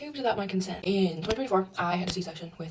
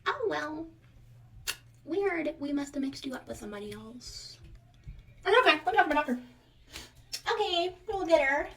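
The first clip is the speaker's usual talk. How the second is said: higher, breezy dismissive voice; mock dismissive nurse; 8 semitones higher than usual. Nurse to patient, brushing off a mix-up, high breezy mock voice. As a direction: play the nurse brushing it off, pitched up.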